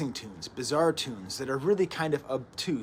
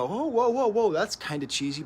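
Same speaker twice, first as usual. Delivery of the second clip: much higher and louder mock outcry; startled whoa; 8 semitones higher, 5 dB louder than usual. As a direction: imitate a listener jolted by something cheesy. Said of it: "you're gonna go".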